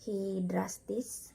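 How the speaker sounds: ordinary talk, nothing marked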